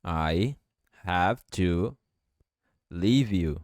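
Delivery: each word set apart, slow; word by word, robotic; "I have to leave you" in slow separate words. Shown as a demonstration. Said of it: "Eu posso juntar o V com o you".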